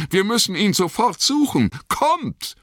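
very high, urgent yell; roaring. A narrator's reading. Sam rallies the others to search, roaring. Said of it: "brüllte Sam".

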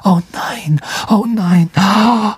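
high, breathy repeated moan; panicky. A narrator's reading. Ron as something big breaks through trees, panicky. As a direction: whimper it in rising dread.

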